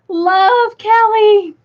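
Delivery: gushing, loud affectionate sign-off. Real, not acted. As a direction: sing out the sign-off fondly. Reading an affectionate sign-off aloud.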